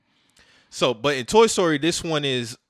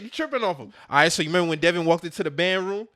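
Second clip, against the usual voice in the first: usual speaking voice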